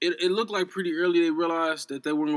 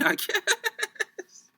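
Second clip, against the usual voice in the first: laughing. Real, not acted. Laughing concession.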